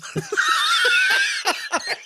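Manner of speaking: very high cracking-up laugh; shrieking laugh. Real, not acted. Cohost cracking up at his own joke about his mom, shrieking laugh. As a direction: let the laugh fly up high and break.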